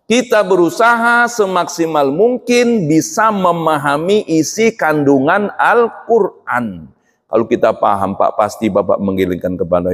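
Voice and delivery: usual speaking voice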